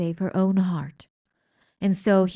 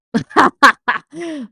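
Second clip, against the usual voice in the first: loud high villain laugh; gleeful cackle; 7 semitones higher, 12 dB louder than usual. A narrator's reading. Old rat cackling at the fight. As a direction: cackle with delight from the sidelines. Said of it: "Botticelli laughed from the sidelines".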